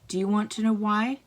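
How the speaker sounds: ordinary delivery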